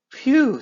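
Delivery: high loud relieved blow; relieved phew. A narrator's reading. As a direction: let out relief. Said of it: "said Mister Fox".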